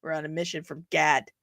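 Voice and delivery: put-on low Midwestern accent, hard vowel on Gad. Streamer quoting a film line, low Midwestern accent. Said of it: "the Midwestern accent thrown in kinda hard".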